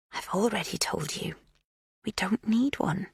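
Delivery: soft menacing reminder; tense whisper. A narrator's reading. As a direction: whisper it with tight control. Magda threatens, face clenched.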